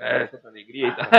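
grumpy guy. Retold or imitated. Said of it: "um cara bem rabugento".

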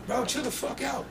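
high, held-back yell without volume; mock yell. Somebody in the corner to the angry rapper, mock yelling. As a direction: imitate a distant yell, high but soft. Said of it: "somebody yells from the corner".

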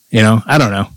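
normal talking voice

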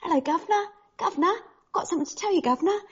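high cheeky cockney "Hello, Governor"; Chippy's cockney accent. Retold or imitated. Wife's silly character Chippy calling her husband Governor in a cockney accent. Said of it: "speaking in that accent".

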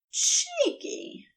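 high, delighted and fond; charmed. Acted. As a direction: sound charmed by it. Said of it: "sounding unreasonably charmed".

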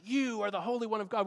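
high-pitched proclaiming spirit; acted spirit crying out in recognition; evil spirit. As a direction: announce it high, like a revelation. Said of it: "here's the Spirit kinda says".

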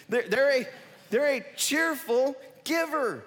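rising high and loud, stretched out; excited. Real, not acted. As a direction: belt it like the happiest news. Preacher's peak, joyful point.

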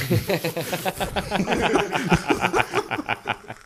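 high laughing fit that keeps going; long laugh. Real, not acted. Cracking up at the blended accent, long laugh. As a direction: crack up completely.

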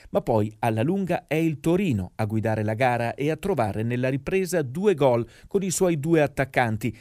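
ordinary talk

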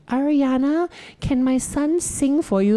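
high, drawn-out sweet request. Retold or imitated. Mom asking [name] to let her son sing, pushy. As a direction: call out to the star in a high mum voice.

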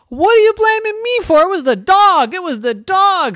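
very high, loud outburst; indignant mommy. Acted. Mommy defending herself to Rose, indignant. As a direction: shriek the blame onto the dog.

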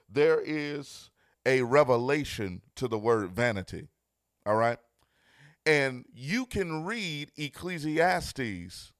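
loud, rising preacher's cadence; full-force preaching. Acted. Pastor driving home love is blind to his viewers, loud preaching. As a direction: preach it with building fire.

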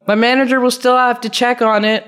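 high-pitched mock officious reply; high, sing-it-out customer service imitation; putting on the clerk.

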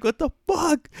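very high incredulous cry; retold shock. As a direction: squeal it in mock disbelief. Retold shocked reply to caller.

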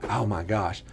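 low, soft, dreading groan of a line. Retold or imitated. Boy dreading the paddle, low and soft. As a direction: mutter it under your breath in dread.

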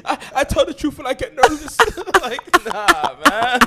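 laughing apology. Real, not acted.

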